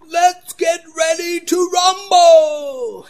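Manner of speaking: high, slow, shouted ring announcer; Bruce Buffer announcer call. Retold or imitated. Preacher imitating the ring announcer's famous call. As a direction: draw it out like a fight-night announcer. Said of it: "trademark phrase".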